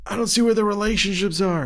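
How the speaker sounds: high-pitched, hurried mock complaint; put-on agent voice. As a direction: imitate a busy agent complaining in a silly high voice. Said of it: "why I'm making that voice".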